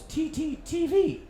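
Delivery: high, slow "T T T V" with an extra T; fumbled TTV. Retold or imitated. Roy Keane flubs the show name on camera, slow and high. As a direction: stumble slowly over "T T T V". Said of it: "he said about two T's too many".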